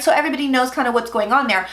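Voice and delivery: ordinary talk, nothing marked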